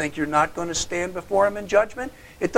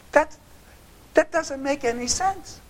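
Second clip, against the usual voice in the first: usual speaking voice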